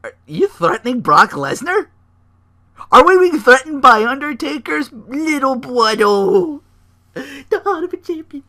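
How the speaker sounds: high, loud, slow mock outrage; hammed-up outraged manager breaking into a laugh; Paul Heyman impression. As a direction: play the indignant advocate for laughs. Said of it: "And Heyman's like".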